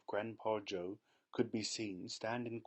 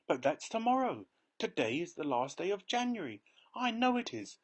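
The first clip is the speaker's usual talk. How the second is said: excited insistence; 9 semitones higher, 4 dB louder than usual. A narrator's reading.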